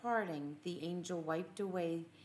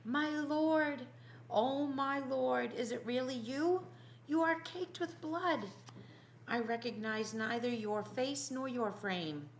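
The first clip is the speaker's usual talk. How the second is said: crying out in shock; 7 semitones higher than usual. Acted. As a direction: gasp it out, hand over mouth, stunned. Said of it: "thrust his hand across his mouth and cried".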